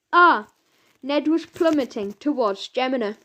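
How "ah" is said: scream. A narrator's reading. Boy falling through sky.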